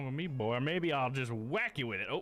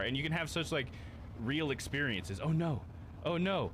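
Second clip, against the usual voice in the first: ordinary talk, nothing marked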